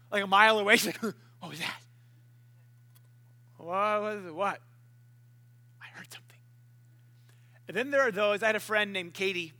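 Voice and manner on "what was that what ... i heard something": hushed, groggy mumble trailing into a whisper; mock half-asleep murmur, barely voiced; imitating a groggy waker. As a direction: mumble it softly, as if just woken. Imitates wife startled awake, sleepy mumbling.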